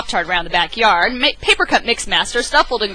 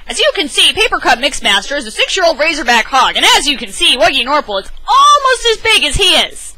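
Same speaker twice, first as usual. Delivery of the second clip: higher, louder eager dad voice; an excited owner bragging about his growing cat; bouncy, proud storybook dad; excited Lunchbox Louie; 6 semitones higher, 5 dB louder than usual. Acted.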